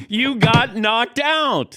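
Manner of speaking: teasing taunt. Acted. Co-host ribbing his partner, slow teasing taunt. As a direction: drag it out to rub it in.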